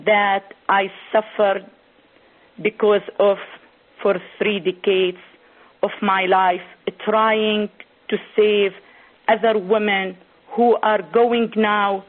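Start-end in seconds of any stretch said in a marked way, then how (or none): none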